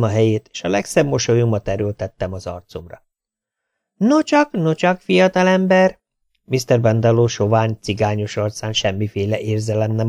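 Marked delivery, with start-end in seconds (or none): 4.0-6.0 much higher, drawn-out nocsak; mock-cheerful greeting. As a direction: greet him with false delight. Tailor greets young vet.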